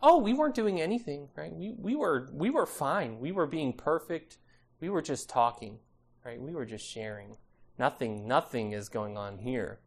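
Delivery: hint of guilty children playing innocent; mock-innocent children. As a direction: feign innocence, fading to a small quiet voice. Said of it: "and mom and dad show up, right, what happens?".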